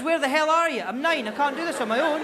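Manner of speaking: put-on high, loud, overwrought child's plea; mock panic. Nine-year-old who can't cope alone, panicked. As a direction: plead like an abandoned kid losing it. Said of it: "a whole new level of panic".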